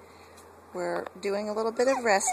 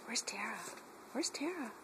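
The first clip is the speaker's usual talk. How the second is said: soft playful; 3 semitones higher, 12 dB quieter than usual. Real, not acted. Playful hide and seek with dog.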